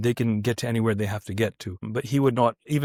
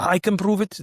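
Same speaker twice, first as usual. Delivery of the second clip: higher-pitched mock Russian accent; 7 semitones higher than usual. Russian doctor insists to a doubter, in a Russian accent. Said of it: "it's a Russian accent again".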